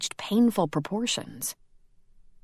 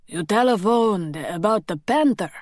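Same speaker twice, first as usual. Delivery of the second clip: lightly voiced Greek accent, a smoker's hoarseness; thick Greek accent; 3 semitones higher, 4 dB louder than usual. A narrator's reading. Island policeman questioning Robbie at the door, thick Greek accent. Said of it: "with a thick accent".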